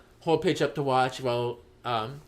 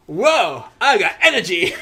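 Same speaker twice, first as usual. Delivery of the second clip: loud, high burst of excitement; hammed-up exhilaration; 7 semitones higher, 11 dB louder, slower than usual. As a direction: shout like someone suddenly powered up. Paige suddenly gets her energy back.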